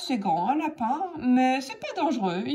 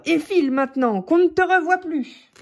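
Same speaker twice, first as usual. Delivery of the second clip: louder, higher commanding order; booming voice; 5 semitones higher, 4 dB louder than usual. Acted. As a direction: send the fox running. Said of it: "avec cette voix à faire trembler les arbres".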